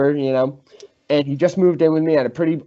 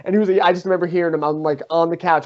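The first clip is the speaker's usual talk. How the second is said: usual speaking voice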